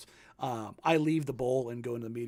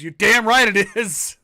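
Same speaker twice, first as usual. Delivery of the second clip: emphatic quote; 6 semitones higher, 13 dB louder, slower than usual. Retold or imitated. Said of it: "He goes".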